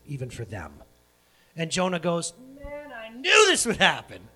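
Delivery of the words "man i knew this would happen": high, loud, drawn-out acted grumble; mock exasperation. Jonah sees Nineveh spared, acted exasperation. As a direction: act out a hammed-up fed-up outburst. Jonah's angry outburst voiced.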